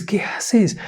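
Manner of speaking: high urgent whisper. A narrator's reading. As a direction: hush it, urgent and breathless. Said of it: "Corre y le dice".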